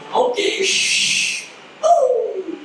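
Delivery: high squealing whoop of delight; gleeful genie whoop. Acted. Genie granting a wish to the woman, high gleeful whoop.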